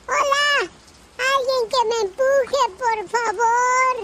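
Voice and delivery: high mock yell, slowing on por favor; calling out for help. Stranded man yelling again for someone to push. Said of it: "el hombre no dejaba de gritar".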